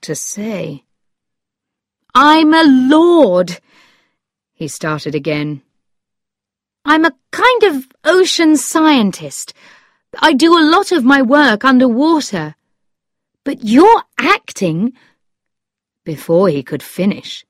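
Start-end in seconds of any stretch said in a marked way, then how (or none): 2.1-3.6 high, loud, slow confession; emphatic storybook lord; Lord Struve confessing. A narrator's reading. 6.8-14.9 high-pitched, animated explanation; emphatic storybook suitor; Lord Struve explaining. A narrator's reading.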